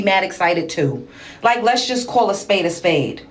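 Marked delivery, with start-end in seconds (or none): none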